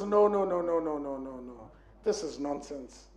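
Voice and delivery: refusal fading from low to almost nothing. Retold or imitated. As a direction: mutter it away as he walks off. Rich man refuses, fading away.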